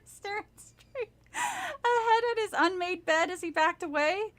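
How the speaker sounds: plain reading voice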